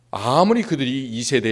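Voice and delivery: usual speaking voice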